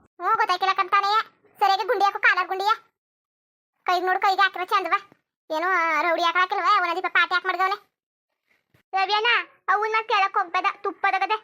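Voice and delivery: mocking her brother's rowdy look, loud; loud teasing. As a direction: call out his flashy look, teasing.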